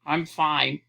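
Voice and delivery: low, grumbly brush-off; grumbled. A narrator's reading. Fallen man to the robot helping him up, grumbling. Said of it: "he grumbled".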